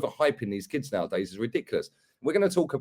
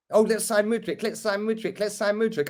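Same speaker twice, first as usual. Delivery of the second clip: pitched up, whiny chant of hopeful fans; mocking fan voice; 7 semitones higher than usual. Mocking the fan base begging to sign Mudryk, whiny mock chant. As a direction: mimic fans pleading over and over.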